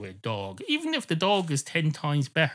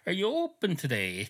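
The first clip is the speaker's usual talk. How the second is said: usual speaking voice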